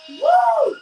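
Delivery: high, loud whoop; excited cheer. Real, not acted. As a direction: let out a big fan whoop. S1 cheers as fighter enters.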